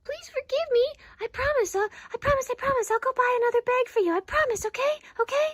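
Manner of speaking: high, urgent begging; pleading. Acted. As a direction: beg forgiveness, promise piled on promise. Begging angry friend for forgiveness.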